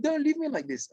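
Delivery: high, begging cry not to be abandoned; retold tearful plea, pitched high. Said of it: "And he started crying".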